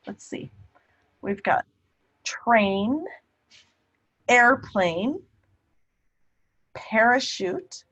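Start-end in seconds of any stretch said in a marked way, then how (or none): none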